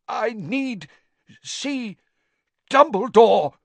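high, broken words pushed out; each word costs a terrible effort; strained effort. Acted. Crazed Crouch begs Harry to see Dumbledore, straining. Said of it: "Every word ... terrible effort".

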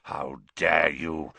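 low, slow menacing growl; deep furious dog-like growl. A narrator's reading. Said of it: "he growled".

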